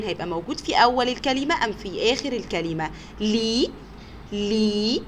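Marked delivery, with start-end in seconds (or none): 3.2-5.0 لي held as a long "lee"; teacher stretching لي as "leee"; sounded-out syllable. Shown as a demonstration. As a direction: isolate لي slowly for listeners to hear. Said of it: "طب نسمع كويس".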